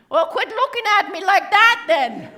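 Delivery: high, loud playful telling-off; mock scolding. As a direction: scold the flirt loudly for laughs. Scolds a staring audience member.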